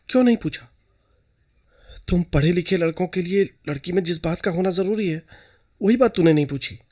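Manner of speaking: can't believe he didn't ask; mother amazed her son never asked; astonished mother. A narrator's reading. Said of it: "बहुत आश्चर्य हुआ".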